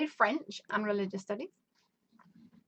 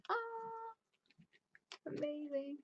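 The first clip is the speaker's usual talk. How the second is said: soft awe; 6 semitones higher, 11 dB quieter, slower than usual. Real, not acted. Reacting to twenty-one red roses.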